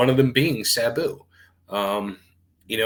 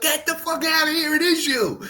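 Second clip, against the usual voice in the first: much higher, incredulous squeal of recognition; excited disbelief; 3 dB louder than usual. Retold or imitated. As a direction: blurt it out like you can't believe who it is. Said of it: "he busted up laughing".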